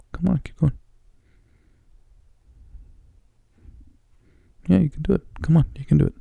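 high, eager coaxing voice; cheering someone on. Real, not acted. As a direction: coax a pet to jump up to you. Coaxing cat to jump up.

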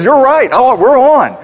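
sudden high, loud "oh, we're on"; startled realization. Retold or imitated. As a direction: snap out of the fight, caught on stage. Guitarist caught mid-fight, switching.

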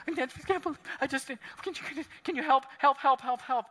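put-on high, pleading child's voice, words tumbling and repeating; mock crying child. Pastor voicing a crying grandchild running up, panicky pleading. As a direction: imitate a panicked little kid begging for help. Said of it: "they come up to you and they're crying".

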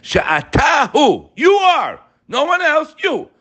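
high, slow, loud declaration; emphatic proclamation. Real, not acted. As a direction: proclaim each phrase with full force. Preacher calling out the praise.

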